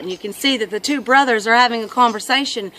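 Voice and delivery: ordinary talk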